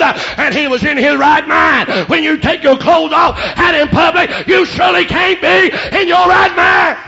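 building to high, loud shout; full-force preaching. Real, not acted. Preacher admonishes congregation about clothing, full-force preaching. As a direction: hammer it home to the congregation.

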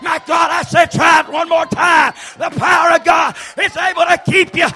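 high, loud whooping preacher cadence; fiery revival preaching; preaching at full force. Acted. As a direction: shout it out, driving each line home. Preacher builds to shouted climax.

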